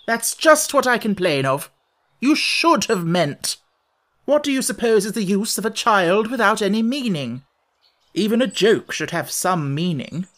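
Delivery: impatient. A narrator's reading. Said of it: "the Red Queen interrupted her impatiently".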